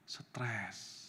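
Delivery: whispered, low and heavy; acted-out weary whisper; hushed stress. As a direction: breathe the word out softly. Wife describing husband's stressed state.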